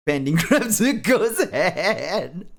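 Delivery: high, loud voice cracking up; slow, helpless laughing words. Real, not acted. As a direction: read it while breaking into laughter. Reader cracks up at own story.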